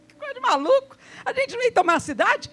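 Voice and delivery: high, laughing mock astonishment; mock disbelief. Preacher mimics the puzzled people, laughing and incredulous. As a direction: scoff like the baffled crowd.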